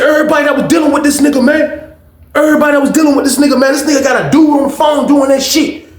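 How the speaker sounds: fast, high retold proclamation; public announcement. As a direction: call it out to the whole crowd at speed. Roommate announces it to everyone.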